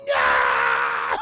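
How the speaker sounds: long, loud hammed-up howl; anguished scream. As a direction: scream in mock horror at the crash. Son screams at the accident.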